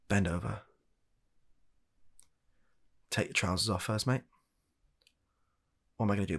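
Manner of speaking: usual speaking voice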